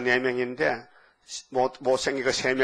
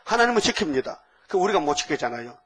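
ordinary delivery

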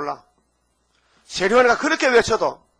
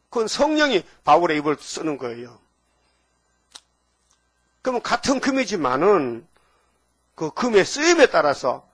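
normal talking voice

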